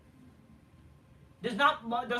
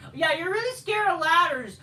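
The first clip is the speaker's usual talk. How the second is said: sarcastic; 5 semitones higher, 7 dB louder than usual. Real, not acted. Sarcastic jab at wrestler.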